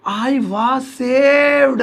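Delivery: long drawn-out "I was saved"; slow dictation. Shown as a demonstration. Teacher writing the passive translation for students, slow dictation. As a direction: stretch every word, holding "saved".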